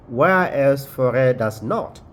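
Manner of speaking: ordinary delivery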